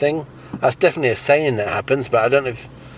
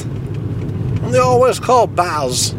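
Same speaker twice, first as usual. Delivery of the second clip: slow high puppet voice; 9 semitones higher, much slower than usual. Acted.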